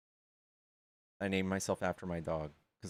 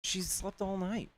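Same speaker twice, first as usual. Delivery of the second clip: much higher, slow doting coo to the dog; pet talk; 12 semitones higher, slower than usual. Real, not acted. Cooing to her dog.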